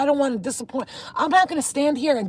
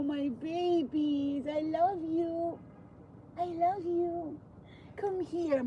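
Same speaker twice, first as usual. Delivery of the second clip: gentle coaxing; 7 semitones higher, 10 dB quieter than usual. Real, not acted. Cat lover coaxing strays into the house, soft high cooing. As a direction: coo softly to lure shy animals closer.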